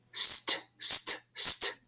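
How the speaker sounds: "st" hissed without voice, repeated; sounding out st. Shown as a demonstration. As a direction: breathe out a crisp "st" three times. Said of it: "two little sounds inside there".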